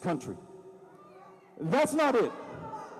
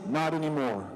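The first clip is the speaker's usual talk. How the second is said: lower, smooth TV anchor read; Fox News anchor voice; 6 semitones lower than usual. Retold or imitated. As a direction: deliver it like a weekend news anchor. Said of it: "in his best Fox News weekend anchor voice".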